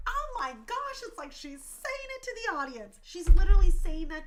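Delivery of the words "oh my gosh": high, thrilled "oh my gosh"; retold gasp of awe. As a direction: relive the moment it hit you, voice leaping up. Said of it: "I literally was like".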